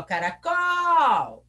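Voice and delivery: CARACOL called out, long loud COL; calling the word. Shown as a demonstration. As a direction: stretch the last syllable of CARACOL like calling someone. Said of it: "Eu chamo a palavra".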